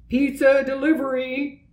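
high, put-on delivery call; unfamiliar voice calling. A narrator's reading. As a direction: call out like a delivery man at the tent. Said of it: "called an unfamiliar voice".

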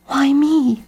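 put-on a kid crying out in despair; cried out. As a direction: Jeb cries out at the bad news. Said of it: "he cried".

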